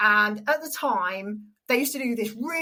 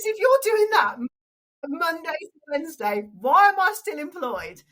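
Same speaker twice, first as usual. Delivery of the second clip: high-pitched imitation of disbelief; mock incredulity; 5 semitones higher, 3 dB louder than usual. Announcer hearing of her radio job, comically incredulous. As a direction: act out a colleague's comic outrage.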